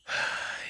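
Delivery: long unvoiced exhale; weary sigh. Host giving in to a difficult guest, weary sigh. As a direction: let out an acted sigh. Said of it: "And he kind of sighs".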